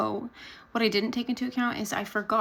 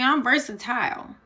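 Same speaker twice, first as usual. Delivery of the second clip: usual speaking voice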